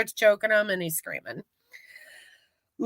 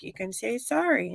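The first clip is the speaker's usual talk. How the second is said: quiet self-coaching mutter; muttering to himself; 10 dB quieter than usual. Retold or imitated. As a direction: talk yourself into it under your breath. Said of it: "trying to talk to himself".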